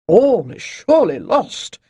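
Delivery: mock horrified. A narrator's reading. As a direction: wail it as pretend doom. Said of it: "mock horrified".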